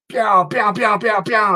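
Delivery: mouth-made pew pew laser shots; laser zaps. Retold or imitated. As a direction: fire off cartoon laser noises. Imitating laser beam shots.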